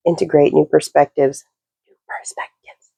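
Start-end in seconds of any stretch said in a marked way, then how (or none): 1.9-2.9 very soft, voiceless whisper; whispered echo. Real, not acted. Reader echoing a phrase from the reading, whispered. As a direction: repeat it as a hushed whisper.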